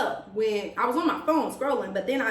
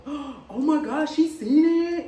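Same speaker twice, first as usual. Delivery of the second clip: mock shocked gasp; 5 semitones higher, 4 dB louder, slower than usual. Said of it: "And he looking like".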